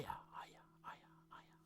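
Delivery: whispered eerie chant; spooky whisper. Acted. Host teasing the next episode with an eerie whisper. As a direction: whisper it like a ghostly omen. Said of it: "spooky series intro".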